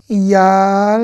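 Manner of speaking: "Yalda" split as a long "yal", then "da"; two syllables. Shown as a demonstration. Teacher breaking the word for learners, "Yal... da" in two syllables. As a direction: stretch "Yal" right out, then place "da" separately. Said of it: "il y a deux syllabes".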